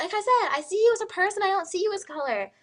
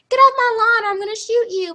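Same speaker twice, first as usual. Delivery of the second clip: high, loud threatening yell; mock angry homeowner; 7 semitones higher, 10 dB louder than usual. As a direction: yell at a trespasser in hammed-up outrage. Acts armed homeowner threatening trespasser.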